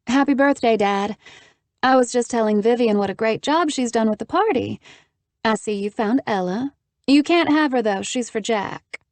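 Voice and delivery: high, bright and teasing; cheerful. Acted. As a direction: greet dad brightly, teasing. Haven greets father teasingly.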